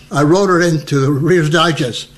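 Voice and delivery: usual speaking voice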